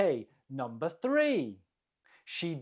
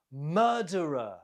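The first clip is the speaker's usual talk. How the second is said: "Murderer" stressed on MUR, falling away after; stress on first syllable. Shown as a demonstration. Teacher models the word for learners, MUR stressed in "Murderer". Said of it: "The stress is on the first syllable".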